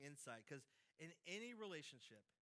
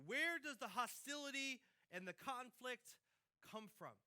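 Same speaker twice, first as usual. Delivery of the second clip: first ask louder and slower, "Where" high; louder, slower question; 5 semitones higher, 5 dB louder than usual. Shown as a demonstration. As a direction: call it out to the congregation. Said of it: "first take of question".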